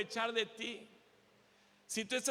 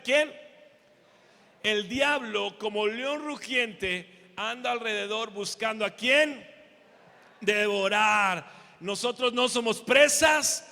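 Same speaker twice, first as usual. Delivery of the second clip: full-force preaching; 9 dB louder than usual. Acted. Pastor rallying the congregation against the devil, booming. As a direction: roar it like a battle cry to the church.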